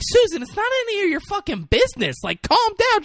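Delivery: high, fast mock scolding; hammed-up heated telling-off; acted angry rebuke. Friends argue back at Susan.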